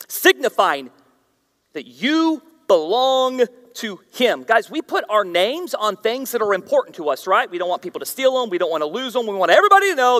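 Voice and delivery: ordinary talk, nothing marked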